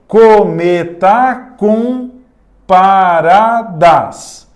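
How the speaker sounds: Cometa com paradas said slowly, com set apart; syllable by syllable. Shown as a demonstration. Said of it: "Olha o com aqui". (separando o com bem devagar)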